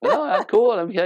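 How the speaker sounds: high, carefree imitated reply; oblivious husband. Clueless husband brushes off hint.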